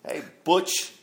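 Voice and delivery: high mocking call of Butch; teasing the cops with a loud, high hey Butch; smart-ass call. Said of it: "call everybody Butch".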